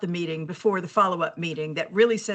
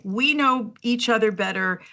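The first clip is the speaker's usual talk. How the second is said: ordinary delivery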